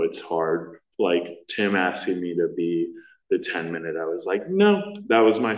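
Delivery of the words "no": sudden high no; startled no. Retold or imitated. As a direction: blurt out a startled no. Refusing request to share.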